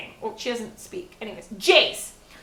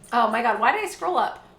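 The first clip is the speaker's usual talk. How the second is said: ordinary talk, nothing marked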